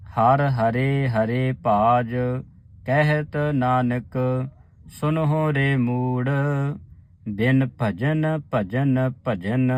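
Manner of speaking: whole line chanted slowly with rests at ਭਾਜ, Nanak and ਭਜਨ; slow recitation with pauses. Shown as a demonstration. Teacher reciting the whole line slowly for the sangat. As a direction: chant slowly and stop at each rest. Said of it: "ਇਸ ਤਰ੍ਹਾਂ ਉਚਾਰਨ ਕਰਨਾ".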